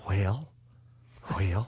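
low, drawn-out folksy president; Ronald Reagan impression. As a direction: drop low and drawl the wells. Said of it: "do this reference".

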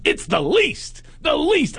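a hammed-up indignant psychic; mock psychic voice. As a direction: insist on the least, climbing. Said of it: "He goes, he goes".

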